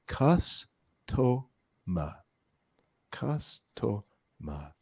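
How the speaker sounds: customer drawn out slowly, stress on CUS; slow syllable-by-syllable. Shown as a demonstration. Teacher modelling the word for Aline, slow and deliberate. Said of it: "break customer down into three".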